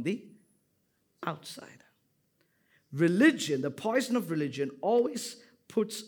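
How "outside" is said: whispered single word. Real, not acted. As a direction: drop to a breathy whisper on the last word. Sermon point trails into whisper.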